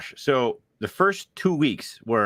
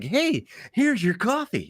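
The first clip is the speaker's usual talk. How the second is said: high, slow, chirpy mock greeting; friendly server; 12 semitones higher, slower than usual. Dunkin' worker handing over coffee, mock friendly. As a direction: greet the customer in a high singsong.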